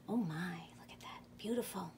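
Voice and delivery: very soft, low awed whisper; whisper, fading almost to nothing; whispered wonder. Acted. Reader admiring the web with children, whispered wonder.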